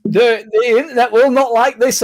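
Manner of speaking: mock the smug guy filming cops; mock self-righteous filmer. Mocking a self-righteous YouTuber to a friend, high whiny voice. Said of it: "and then be like".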